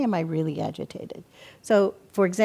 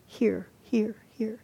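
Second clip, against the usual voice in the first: soft whispered hear, hear, hear; hushed listening demo; 4 dB quieter, slower than usual. Shown as a demonstration. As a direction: breathe the word out like noticing a sound. Demonstrating mindful listening.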